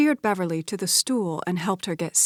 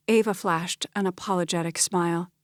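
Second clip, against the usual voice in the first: ordinary delivery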